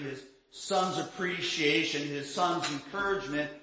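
ordinary talk, nothing marked

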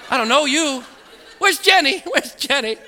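high mock-panic building to a yell; comic panic. Patient facing a new nurse, mock panic building. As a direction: call out desperately for a familiar face.

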